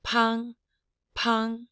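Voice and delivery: PANG held high and level; high bell tone. Shown as a demonstration. Teacher giving the finished word, high bell tone on PANG. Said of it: "So this word is".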